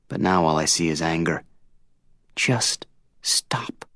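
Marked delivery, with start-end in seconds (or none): 2.3-3.8 whispered, barely there; quiet whisper. A narrator's reading. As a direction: whisper it, drained. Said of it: "Her quiet voice".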